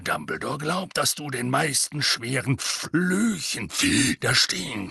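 slow grizzled warning voice; a gruff veteran lecturing; gruff auror. Acted.

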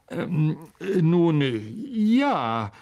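hemming, drawn-out admission; stall, then reluctantly own up; hesitant, awkward professor voice; sheepish Lockhart. A narrator's reading. Lockhart reluctantly admits leaving.

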